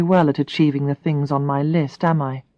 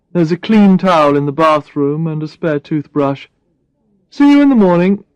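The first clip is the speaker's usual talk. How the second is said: Brad; 3 dB louder than usual. Acted. Brad voiced by narrator.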